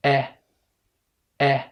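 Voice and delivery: "أ" as a brief eh; light, short "أ" as in set; short English E. Shown as a demonstration. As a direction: mouth slightly open on "أ", sounding eh. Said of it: "وبفتح بقي فيها".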